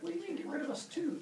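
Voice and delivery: put-on high, quick kid voices; alarmed children. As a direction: play the kids taken aback, fast and high. Worried children question their father.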